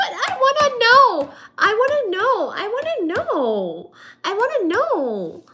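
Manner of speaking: very high, hammed-up wail; exasperated pleading. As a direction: plead over and over, starting loud, then stretching it out. Frustrated plea for answers, repeated.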